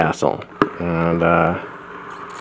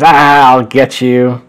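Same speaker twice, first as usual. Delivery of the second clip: slow, louder villain cry; Satan's defeated vow of revenge; villain threat; 3 semitones higher, 6 dB louder, slower than usual. Acted. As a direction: drag out the threat. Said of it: "Satan screams".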